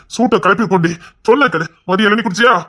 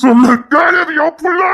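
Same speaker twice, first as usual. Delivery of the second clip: high-pitched, tearful shout; desperate cry; 9 semitones higher, 4 dB louder than usual. Acted. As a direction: plead as if breaking down. Husband desperate about his child.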